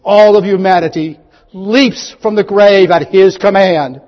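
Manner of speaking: loud, high pulpit declaration; full-force preaching. Acted. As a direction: ring it out as the sermon's climax. Preacher at climax after the shout.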